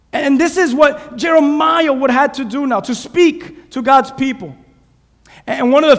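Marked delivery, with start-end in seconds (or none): 0.1-4.5 raised preaching call to the congregation. Real, not acted. Pastor calls out Jeremiah's task to the congregation, preaching. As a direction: proclaim it to the church.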